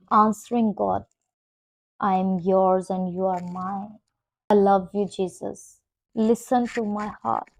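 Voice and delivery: low, hushed, tender prayer; soft prayer. Real, not acted. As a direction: pray softly and intimately. Soft intimate prayer, lower.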